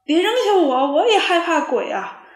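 loud, high panicked cry; frightened plea. A narrator's reading. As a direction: beg not to be left behind. Wolf fleeing a ghost. (害怕地大喊)

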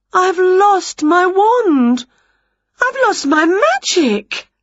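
high, loud drawn-out wail; slow, high-pitched theatrical wailing. A narrator's reading. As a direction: wail in despair over a loss. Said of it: "wailed Winnie".

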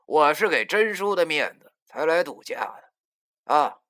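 high, loud, drawn-out brazen boast; cocky, put-on insolence; insolent rascal. A narrator's reading. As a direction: play the shameless rogue, slow and loud. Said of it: "摆出了他那副无赖样儿".

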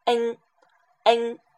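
"N" repeated with the mouth opened a bit wider; model vowel sound. Shown as a demonstration. Teacher demonstrating the Cantonese vowel with a wider mouth. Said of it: "这个N的发音".

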